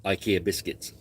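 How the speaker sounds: ordinary talk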